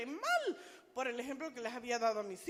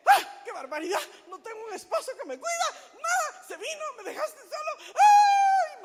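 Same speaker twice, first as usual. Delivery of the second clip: high, very loud outburst; dramatic reenacted yelling rant; acted angry explosion; 12 semitones higher, 15 dB louder than usual. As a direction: explode at the husband who left you, ending in a scream. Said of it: "llegué y exploté".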